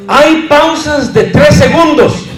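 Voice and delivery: loud, high announcement cut off into silence; pause demonstration. Shown as a demonstration. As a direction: proclaim "hay pausas de tres segundos", then hold the pause. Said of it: "Ahora noten".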